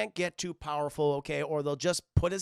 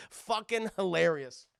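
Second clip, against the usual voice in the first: normal talking voice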